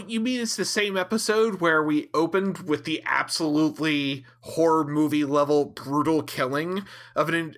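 normal talking voice

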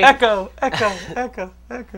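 cave echo. Pretend. Playing hero in empty game world.

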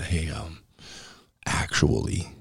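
put-on sheepish kid owning up quietly; mild imitation of a nervous kid interrupting; timid kid. Kid sheepishly correcting the boss.

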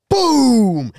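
mock impact boom. Imitating sledgehammer hit.

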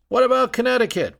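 quick, high jeering shout; heckler's shout. Retold or imitated. A drunk heckler shouts at Bryan in a fast, high jeer. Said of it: "one shouted".

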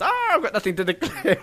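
soft impression of a Bond actor; lightly acted one-liner, softened; put-on Bond impression. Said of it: "And what's the presence?".

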